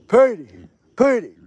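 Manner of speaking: loud high call to the pet. Real, not acted. Owner calling her pet across the farm, loud call.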